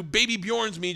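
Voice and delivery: ordinary talk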